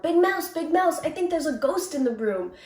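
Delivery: little mouse yelling about a ghost; frightened yell. A narrator's reading. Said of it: "he yelled".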